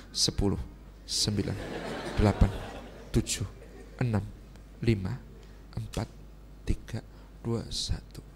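low-pitched, soft, spaced-out numbers; hushed countdown. Shown as a demonstration. Husband silently waits out wife's anger, hushed countdown. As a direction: count down quietly, pausing after each.

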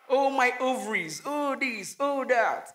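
lightly sighed, mock-swooning; playful gush. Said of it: "So go".

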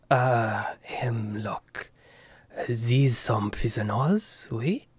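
put-on soft French-accented old man, half whispered. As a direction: hush it with a French lilt. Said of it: "tell he's Louis' brother by the way that he speaks".

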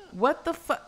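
high, exasperated demand; irritated what do you want. Real, not acted. Gamer fed up with the neighbor barging in, exasperated. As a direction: snap at the unwanted visitor.

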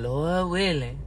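doubtful tone. Shown as a demonstration. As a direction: say "Lord willing" like you don't believe it. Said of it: "look at that tone".

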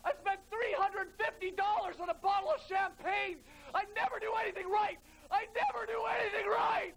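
whiny self-pity. Acted. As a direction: whine like a wounded child. Mock meltdown at dinner.

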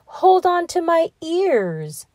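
high, slow sly fox; sly fox coaxing. A narrator's reading. Fox coaxes the gingerbread man onto his tail, high and slow. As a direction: coax him on, slowly. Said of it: "said the fox".

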